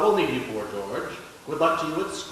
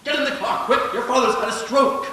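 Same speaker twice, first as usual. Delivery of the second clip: urgent alarm; 7 semitones higher, 4 dB louder than usual. Acted. Urgent news of father's stroke.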